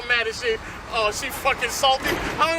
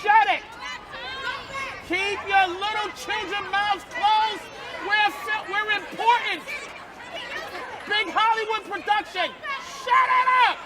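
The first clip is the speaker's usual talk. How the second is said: yelling; 5 semitones higher, slower than usual. Real, not acted. Comedian shushing kids during a big Hollywood production, yelling. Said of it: "yelling at us".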